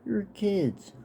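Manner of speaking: high-pitched retold snap, said slowly; snapping. Boy snapping at the neighbour kid who stole his Lego tank. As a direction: snap at the thief like a kid again. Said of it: "I snapped at him".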